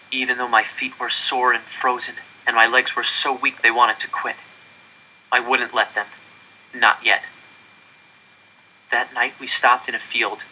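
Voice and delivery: usual speaking voice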